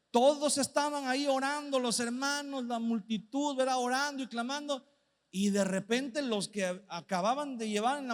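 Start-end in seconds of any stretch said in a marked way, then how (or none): none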